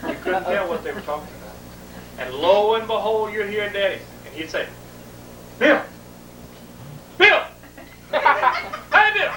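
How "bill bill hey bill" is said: high, loud calls from bed; Dad calling out. Retold or imitated. Dad calling his son from bed, high and loud. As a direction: call out like a whiny dad.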